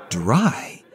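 ordinary delivery, nothing marked

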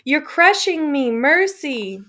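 high, loud crushed plea for mercy; rattling cry. Acted. Man crushed beneath the prisoners cries out for mercy, high and loud. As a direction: cry out from under the bodies. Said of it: "someone let out a rattling cry".

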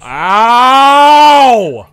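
loud high ow; mock pain yell. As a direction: yelp as if cut. Character cut.

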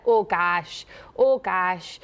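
"Oh gosh" with a long, rounded Minnesotan O; Minnesota long O. Shown as a demonstration. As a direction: stretch the O in "Oh" Minnesota-style, open vowel in "gosh". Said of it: "it was like that".

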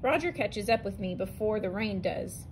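ordinary delivery

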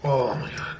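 ordinary talk

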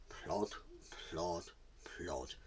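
low, soft, spaced-out plods; hushed trudging rhythm with pauses; slow plodding. A narrator's reading. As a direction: drop each word like a heavy footstep. Imitating tortoise's slow trudge.

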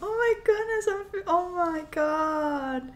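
high, gasping amazement, slowing at the end; real high-pitched emotional outburst, easing down; overcome with emotion. Real, not acted. Viewer spotting the singer's parents, overcome with emotion.

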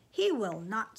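loud, emphatic contradiction; firm refusal. A narrator's reading. Mother overruling father about Sam fighting, firm. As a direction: put your foot down forcefully. Mother firmly forbids son.

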